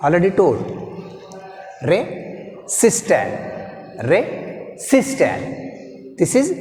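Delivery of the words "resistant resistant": "Resistant" said very slowly, long pause after "Re"; split into syllables. Shown as a demonstration. Teacher separating first part from second, slow split syllables. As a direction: break "Resistant" into "Re" ... "sistant". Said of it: "second one, this is first one".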